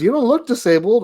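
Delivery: high, mock-accusing tone; mocking imitation. Mimicking what strangers say.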